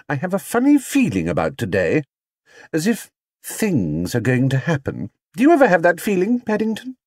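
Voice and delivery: uneasy Mrs Brown. Acted. Mrs Brown to Paddington, uneasy. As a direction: share a funny feeling.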